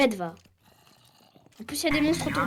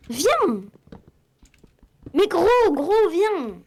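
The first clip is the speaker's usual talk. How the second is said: loud, high pleading call; appel insistant; 6 semitones higher, 5 dB louder, much slower than usual. Real, not acted. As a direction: plead loudly for a teammate to return. Rappelle son coéquipier. (appel insistant, crié)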